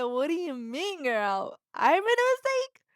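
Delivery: put-on what do you mean, girl; sassy outraged pitch jump; incredulous sass. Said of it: "I said".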